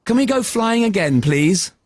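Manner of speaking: put-on pleading boy.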